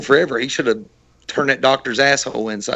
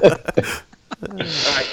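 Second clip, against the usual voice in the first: usual speaking voice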